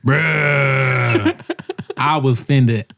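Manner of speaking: hammed-up storyteller from the South; put-on Southern voice. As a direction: stretch the words in a mock Southern drawl. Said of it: "I just love doing the voice".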